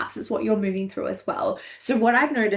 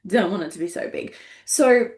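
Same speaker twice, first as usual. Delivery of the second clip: ordinary delivery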